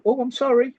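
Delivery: high, surprised little "Oh, I'm sorry"; reflexive apology. Retold or imitated. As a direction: squeak out an automatic apology after being bumped. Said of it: "we would turn around and go".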